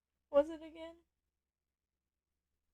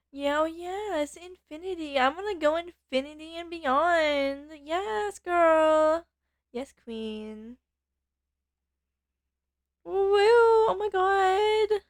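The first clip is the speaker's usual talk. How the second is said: put-on higher, louder Valley Girl cheer, drawn out; excited Valley Girl; 4 semitones higher, 5 dB louder, slower than usual. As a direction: squeal it like a thrilled Valley Girl. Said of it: "Valley Girl is Valley Girl".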